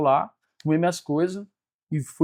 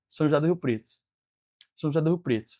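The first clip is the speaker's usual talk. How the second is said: voice of God heard; 5 dB quieter, faster than usual. Retold or imitated. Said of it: "Aí de novo".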